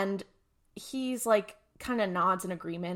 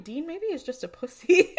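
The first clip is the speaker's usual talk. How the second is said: mock sass; 8 semitones higher, 6 dB louder than usual. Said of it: "I'm kinda like".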